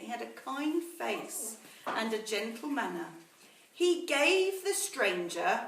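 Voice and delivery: put-on slow, measured storytelling to children; story narration. A narrator's reading. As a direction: read it like a bedtime story to the class. Teacher reads story to class.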